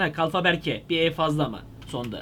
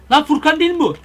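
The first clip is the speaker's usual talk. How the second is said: loud, high-pitched surprise; startled shout on spotting a friend; astonished recognition; 8 semitones higher, 9 dB louder than usual. Real, not acted. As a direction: blurt it out in disbelief. Suddenly recognises a friend online.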